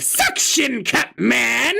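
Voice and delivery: slow, high, shouted name; Suction Cup Man voice. Shown as a demonstration. Announcing his awful impression to the viewers, slow high shout. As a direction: bellow it out slowly, hammed up. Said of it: "my awful impression of".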